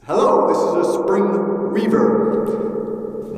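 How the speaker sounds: slow, boingy spring reverb on the voice; spring reverb effect. Shown as a demonstration. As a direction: speak through a springy, twangy reverb. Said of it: "They sound like this".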